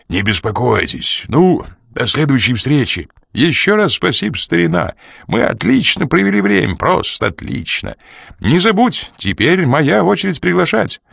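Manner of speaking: выше и бодрее, благодарит; перекрикивая мотор, тепло прощается; другой мужчина. A narrator's reading. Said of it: "Другой мужской голос перекрыл шум". (второй мужчина прощается у машины)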